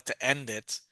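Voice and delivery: normal talking voice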